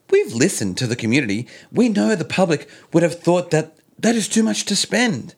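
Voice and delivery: lightly voiced politician quote; Gladys impression. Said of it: "my best impersonation of Gladys".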